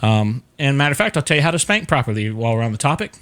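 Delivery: ordinary talk, nothing marked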